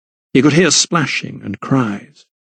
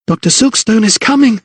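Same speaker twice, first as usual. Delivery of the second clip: high, urgent warning called out; breathless cry, pitched well up; panted warning; 10 semitones higher than usual. A narrator's reading. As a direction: warn them off in a high, panting voice. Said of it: "she panted".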